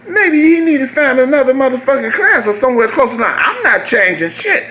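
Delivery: high, hammed-up defiant rant. Answering his wife's advice in a high mock-defiant voice. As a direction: scoff at the idea.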